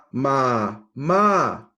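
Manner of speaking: ordinary talk, nothing marked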